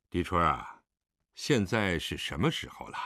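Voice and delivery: 压低嗓音，沉稳地问; low, heavy-voiced question from the master. A narrator's reading. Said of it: "狄仁杰沉声道". (低沉地发问)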